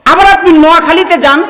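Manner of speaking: normal talking voice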